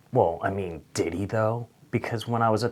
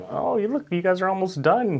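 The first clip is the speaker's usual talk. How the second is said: much higher, sing-song server voice; fake-cheerful waiter; 9 semitones higher than usual. Waiter bringing the late drink to Jamie's table, fake-cheerful. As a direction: sweeten it like forced customer service. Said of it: "in my head I'm like".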